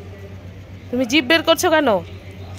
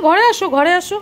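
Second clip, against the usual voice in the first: higher, sing-song calling to the dog; coaxing pet talk; 4 semitones higher than usual. Real, not acted. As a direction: coax the pet indoors.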